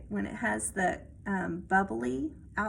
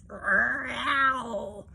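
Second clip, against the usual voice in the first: growl. Acted. Troll cat growling.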